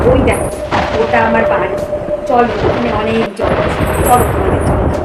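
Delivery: loud, low, hollow ghostly voice; eerie ghost woman. Acted. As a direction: call to them like a spirit luring children. Ghost lures girls to house.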